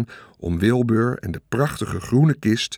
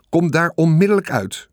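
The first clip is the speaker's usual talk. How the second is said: high, raised-voice command; mother's sharp call; 6 semitones higher, 4 dB louder than usual. A narrator's reading. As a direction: call the child out sternly. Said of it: "riep mevrouw Gersteman". (streng roepende moeder)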